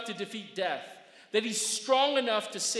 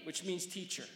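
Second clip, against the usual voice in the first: ordinary talk, nothing marked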